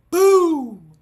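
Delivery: sudden loud, booming "boom"; explosive mimicked car crash; loud crash imitation. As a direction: burst out with the sound of the impact.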